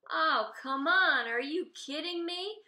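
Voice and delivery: loud, slow, incredulous retort; shooting back. Acted. A fisherman regular shooting back at the cook, loud and incredulous. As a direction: throw it straight back at her. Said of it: "one of the guys shoots back".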